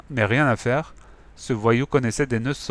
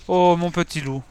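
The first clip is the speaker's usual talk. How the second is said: higher, delighted cry; a boy greeting his beloved wolf cub; tender delight; 6 semitones higher than usual. A narrator's reading. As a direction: sound overjoyed at the cub.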